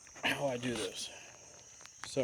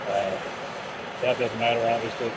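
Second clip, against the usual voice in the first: raised voice over noise; 2 semitones higher, 5 dB louder than usual. Real, not acted. Talking over running torch.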